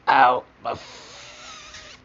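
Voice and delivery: high, loud yelp of pain; pained cry. Pretend. Pretend injury in toy play.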